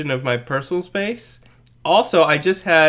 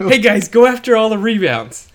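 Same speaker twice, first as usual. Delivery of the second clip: raised, louder imitation of a coach; mock coach; 9 semitones higher, 5 dB louder than usual. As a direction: call out to the team like a coach. Mimicking a coach in a huddle.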